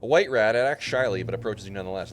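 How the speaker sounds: excited reading. Real, not acted. Reading rat text excitedly.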